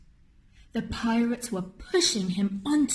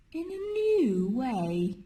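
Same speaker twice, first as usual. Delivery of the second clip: plain reading voice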